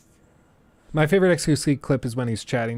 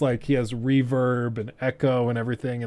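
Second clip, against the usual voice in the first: normal talking voice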